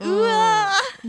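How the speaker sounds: slow, high, loud fake wail; mock wail. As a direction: wail it like a hammed-up sob. Mock wail at the new name.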